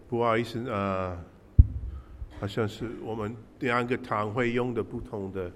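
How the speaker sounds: usual speaking voice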